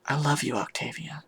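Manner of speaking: very soft, intimate near-whisper. Acted. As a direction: confess love softly into an ear. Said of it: "I lean in and whisper in her ear".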